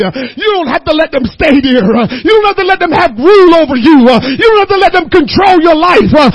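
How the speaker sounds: loud, rising preacher's cadence; preaching. Acted. Preacher urging his congregation, loud and rising. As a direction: preach it with growing force.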